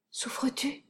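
ordinary delivery

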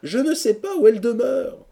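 very high, loud, helpless complaint; lovelorn lament. A narrator's reading. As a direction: wail it as a thwarted lover. Lovesick hero laments to friends. (plainte aiguë d'amoureux impuissant)

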